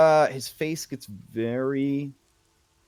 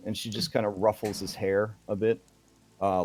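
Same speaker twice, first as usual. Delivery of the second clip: ordinary delivery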